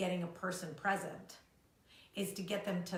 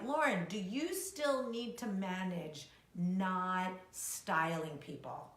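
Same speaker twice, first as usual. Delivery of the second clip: slow teasing question; mock viewer question; much slower than usual. Coach imitating a viewer asking slowly, at a crawl. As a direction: draw out the question like a doubting fan. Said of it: "You're like".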